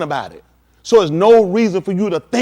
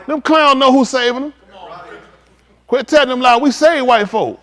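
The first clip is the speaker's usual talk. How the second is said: loud street preacher in full flow; fired-up sermon to the congregation; fiery preaching; 4 semitones higher, 4 dB louder than usual. Acted. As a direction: preach it hard at the crowd.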